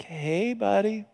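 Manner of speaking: soft, careful approach; tentative greeting. Retold or imitated. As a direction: say it gingerly, as to someone fragile. Cautious greeting to tearful stranger.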